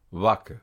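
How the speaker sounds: "Vac" with a clear initial V; plain V. Shown as a demonstration. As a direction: say "Vac" starting on V. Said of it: "V prononcé V". (« Vac » avec un V net)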